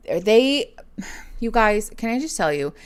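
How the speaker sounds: normal talking voice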